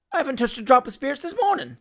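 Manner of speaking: fast, high, plaintive denial; wronged little objection; high-pitched protest. Acted. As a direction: plead sobriety to the magistrate. Accused man protests his sobriety.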